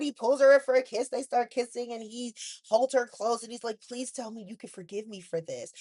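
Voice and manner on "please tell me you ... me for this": soft pleading. Retold or imitated. Will pleading with Elektra.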